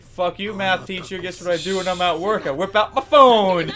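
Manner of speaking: mock defiant rant. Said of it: "It's like".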